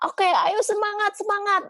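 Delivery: shrill-free but very high hammed-up cheering; mock hype. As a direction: parody the empty rah-rah friend. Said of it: "Nggak semudah itu".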